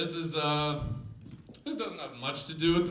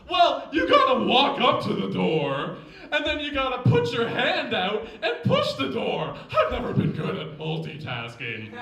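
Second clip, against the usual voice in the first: loud, higher put-on sketch voice; eager crewman; 5 semitones higher, 6 dB louder than usual. Crewman Jim proudly reporting to his captain, eager and loud. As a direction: report proudly at full volume.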